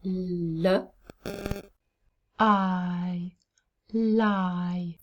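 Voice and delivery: l and long i apart, then blended as lie; sounding out. Shown as a demonstration. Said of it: "Second syllable".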